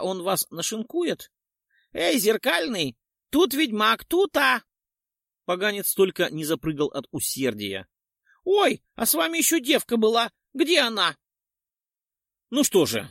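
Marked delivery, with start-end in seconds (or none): 1.9-4.6 high, louder ghoul shout; calling out. A narrator's reading. Ghoul calls the mirror knight to attack, shouting high. 8.4-11.2 high, louder sly ghoul voice; nosy ghoul. A narrator's reading. Ghoul asks where the girl went, high and sly.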